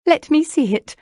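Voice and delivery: high, loud, delighted demand; gleeful eagerness. A narrator's reading. Lucy claps her hands at Jane's find, gleefully eager. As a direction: burst out with eager glee.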